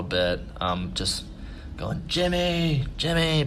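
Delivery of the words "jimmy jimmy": high, drawn-out search call; mock calling for a lost kid. Walking around the grounds looking for Jimmy, calling out.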